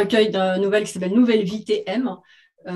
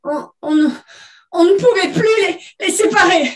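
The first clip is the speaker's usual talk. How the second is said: high, loud, halting mock sobs; broken, hiccuping voice; 8 semitones higher, 7 dB louder than usual. Grieving mother to the guests, broken hiccuping voice. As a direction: choke the words out in broken bursts. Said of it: "hoqueta Agathe, la voix brisée".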